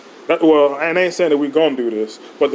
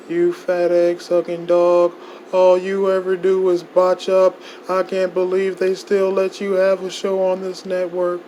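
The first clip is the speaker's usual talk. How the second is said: mocking rival voice; 4 semitones higher than usual. Insulting voice of Daniel Dingo.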